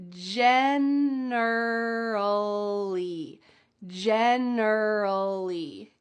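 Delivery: generally stretched out very slowly; teacher slowing generally way down; very slow syllable by syllable. Shown as a demonstration. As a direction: drag out every syllable of generally. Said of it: "Let's start with the first one".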